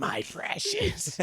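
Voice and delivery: very high, drawn-out creature voice; slow, strangled hiss breaking into laughter; Gollum. Acted. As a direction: do a squeaky, creepy croon. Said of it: "I look like Gollum".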